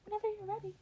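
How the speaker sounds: high, loud and fast urging; quick chirpy go-ahead; eager Nezu. Acted. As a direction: wave him on, impatient to see. Nezu urges Denny on.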